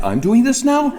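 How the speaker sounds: high, laughing disbelief; surprised. Retold or imitated. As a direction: squeak it out in amused shock. Listener surprised by sudden go-ahead.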